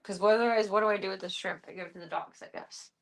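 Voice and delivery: normal talking voice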